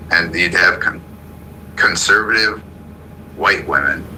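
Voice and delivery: normal talking voice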